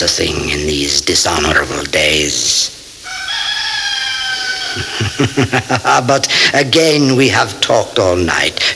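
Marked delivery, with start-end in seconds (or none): 3.0-6.0 very high, eerie drawn-out laugh; sinister laugh. Acted. The Count laughing at his guest over blood, sinister cackle. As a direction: cackle like a creature of the night.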